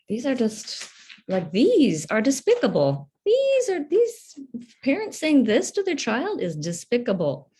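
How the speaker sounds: high, impassioned outrage; real indignation. Real, not acted. Reacting to parents shaming their child, indignant. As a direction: voice leaping up in condemnation.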